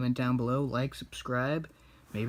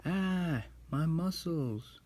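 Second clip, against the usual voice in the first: higher, slow mock groan of pain; a kid voicing a villain in pain; 6 semitones higher, much slower than usual. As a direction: whine about sore muscles in play. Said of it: "He's like".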